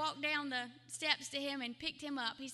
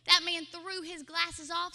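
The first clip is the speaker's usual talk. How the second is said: ordinary talk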